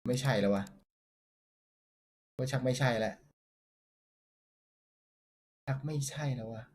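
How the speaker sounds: real disappointment at the pull; disappointed mumble. Real, not acted. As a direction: deflate under your breath. Bad card, disappointed.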